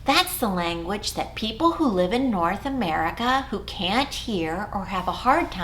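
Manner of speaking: ordinary talk, nothing marked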